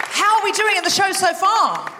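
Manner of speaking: excited compere shout; compere hype. Acted. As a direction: whip up the crowd. Host hypes crowd.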